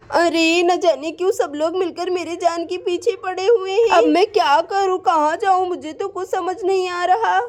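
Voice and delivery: ordinary delivery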